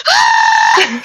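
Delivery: loud retold scream; excited comic shriek, acted out; mock scream. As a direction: scream it out, half laughing. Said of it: "like, screaming".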